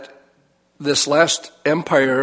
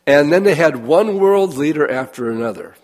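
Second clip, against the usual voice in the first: usual speaking voice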